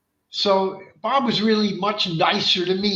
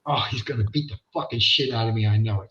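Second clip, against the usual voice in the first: nervous self-talk; 7 semitones lower, faster than usual. Retold or imitated. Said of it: "I'm going".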